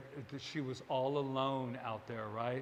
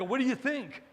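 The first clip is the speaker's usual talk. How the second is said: eager, high and loud; excited like a kid; 7 semitones higher, 5 dB louder than usual. Acted. Speaker eagerly sharing an idea like a kid, excited. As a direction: blurt it out, hungry for approval. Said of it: "I'm excited like this kid".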